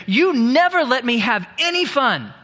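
put-on a pouting kid told no; sulky child. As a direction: stamp your foot and whine like a child. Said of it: "stomp their foot, get a growl on their face".

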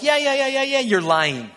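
loud, high run of teasing yeahs; lightly mocking, rushed and disbelieving; mock jeering. Locals doubting the tourist's excuse, mock jeering. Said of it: "kinda laughing a little bit".

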